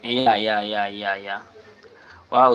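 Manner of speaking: normal talking voice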